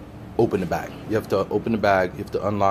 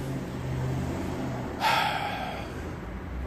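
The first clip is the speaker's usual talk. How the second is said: long sigh; 5 semitones higher, 7 dB quieter than usual. Real, not acted. Weary sigh over the bags.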